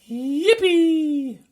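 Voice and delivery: high, loud whoop of glee; a boy cheering on finding the Yeti; joyful shout. A narrator's reading. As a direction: yell it in delight. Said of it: "shouted George".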